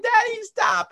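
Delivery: put-on high giggly child pleading; little kid voice. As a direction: squeal it like a laughing toddler. Dad imitates laughing son.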